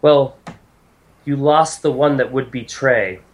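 lightly voiced, deliberate deity; a hint of formal divine gravity; solemn goddess. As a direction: answer with a goddess's measured certainty. Game master answers as the goddess.

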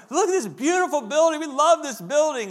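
wide-eyed awe at a huge building; gushing disciples. Retold or imitated. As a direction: rave about the building like tourists. Disciples gush, retold again.

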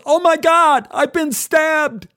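high, loud cry of shock; shrieking "I've been stabbed!" in a panic; panicked yell. Retold or imitated. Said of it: "He yelled".

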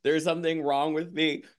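retelling her breakdown with a comic whimper; mock crying. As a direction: whine it like a hammed-up sob. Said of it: "crying and I'm like".

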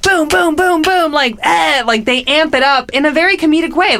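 high, hyped-up rambling; excited. Real, not acted. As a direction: pump it up like an announcer. Describing hyped commercial energy.

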